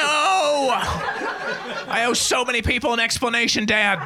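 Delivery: high, drawn-out hammed-up plea; mock distress. Acting out a plea to Dad, mock-distressed. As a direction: wail it in mock despair.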